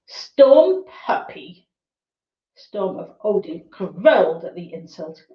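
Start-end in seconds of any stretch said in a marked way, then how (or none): 0.1-1.7 indignant, high, slow outrage. Acted. Hound outraged at insult.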